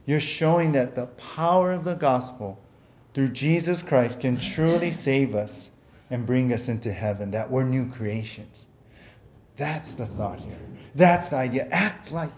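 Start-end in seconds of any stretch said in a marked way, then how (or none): none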